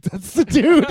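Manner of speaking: stunned realization. Retold or imitated. Realizing Eric was the victim.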